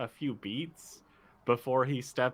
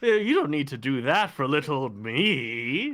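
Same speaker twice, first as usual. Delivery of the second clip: false modesty; 2 semitones higher, 5 dB louder than usual. Acted. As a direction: pretend to wave off the bows with fake humility. Pontifex feigning modesty at the bows.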